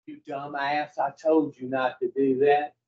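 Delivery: low, understated scolding; scolding inner voice. Acted. Inner voice chiding someone facing consequences, low mild scolding. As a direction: lightly voice an inner voice saying I-told-you-so. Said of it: "does that voice come back louder a second time".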